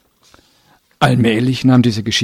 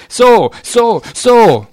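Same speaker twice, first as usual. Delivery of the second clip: high, loud bursts; bellowed; 12 semitones higher, 7 dB louder, much slower than usual. A narrator's reading. Boy hitting the man's chest, bellowing. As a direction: punch out each word in a high yell. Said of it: "und brüllte".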